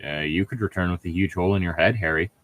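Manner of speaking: ordinary delivery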